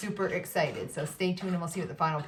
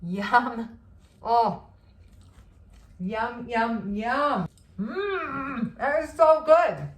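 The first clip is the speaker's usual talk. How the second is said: delighted mm and yum on tasting; savoring delicious food; 3 semitones higher, 4 dB louder, much slower than usual. Real, not acted. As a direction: savor it, then gush louder. First bite of cake.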